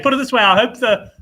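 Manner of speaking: usual speaking voice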